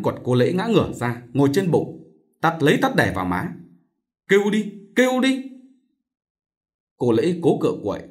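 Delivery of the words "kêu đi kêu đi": high-pitched, goading dare; angry taunt. A narrator's reading. Chồng vừa đánh vừa thách. (giận dữ thách thức)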